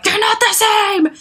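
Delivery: high, loud mock protest; exaggerated, high-pitched indignant yell; indignant objector. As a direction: shout it like an outraged stranger correcting you. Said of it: "someone out there is like".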